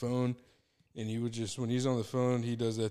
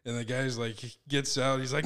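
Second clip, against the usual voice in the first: usual speaking voice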